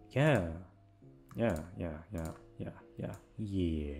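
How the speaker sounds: ordinary delivery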